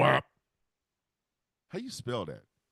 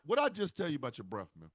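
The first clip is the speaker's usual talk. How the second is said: normal talking voice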